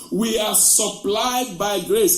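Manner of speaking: loud, driving sermon voice; full-force preaching. Real, not acted. Pastor rousing the congregation, loud preaching. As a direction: preach it out to the back row.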